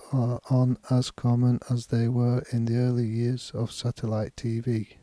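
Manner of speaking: steady level reading with no word stressed; unstressed reading. Shown as a demonstration. Teacher demonstrates unstressed English to learners, steady and level. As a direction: read it flat and even, no emphasis anywhere. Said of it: "The first reading will be without stress on any word".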